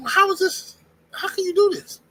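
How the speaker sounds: very high-pitched, baffled question; acted incredulity. Visitor questioning dudes selling weed, acted incredulity.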